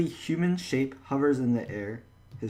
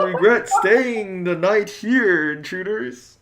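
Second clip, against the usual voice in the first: high, slow, spectral threat; the ghost warns the sleepers, slow and eerie; 6 semitones higher, 5 dB louder, slower than usual. Acted. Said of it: "Do you like my ghost voice?".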